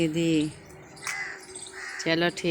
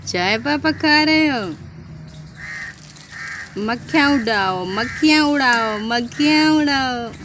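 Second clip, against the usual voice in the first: put-on high, louder childlike voice; voicing the child; 6 semitones higher, 5 dB louder than usual. Father voicing his toddler son's words, lightly childlike and high. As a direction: speak for the little boy in a high piping voice. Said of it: "ये डोलू भी कह रहा है".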